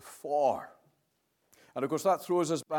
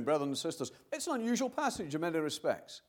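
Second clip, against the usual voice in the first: normal talking voice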